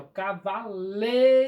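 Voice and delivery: cavaleiro with LEI punched loud and long; ca-va-LEI-ro, hit "lei" hard; loud stretched "lei" inside "cavaleiro"; stress on LEI. Shown as a demonstration. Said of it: "Então, lei é o mais".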